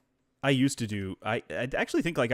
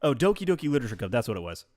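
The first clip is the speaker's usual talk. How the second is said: ordinary talk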